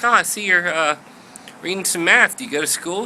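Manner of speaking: high, nosy Southern voice; high-pitched Southern accent; chatty Southern lady. Acted. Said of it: "She's from the South".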